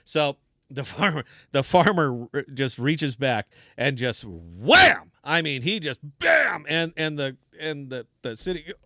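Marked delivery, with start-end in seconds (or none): 4.2-5.1 loud explosive "wham"; sudden shouted comic impact; imitated kick impact. Imitates the farmer's hard kick. 6.2-6.6 high shouted "bam"; comic impact shout; imitated kick impact.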